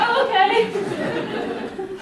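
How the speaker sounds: high, drawn out, laughing; amused awkward acceptance; laughing slow oh okay. Retold or imitated. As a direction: stretch it out through a laugh. Manager's awkward reply.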